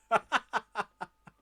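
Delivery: loud high cackle of a jester; jester laugh. Acted. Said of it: "Your voice for the jester".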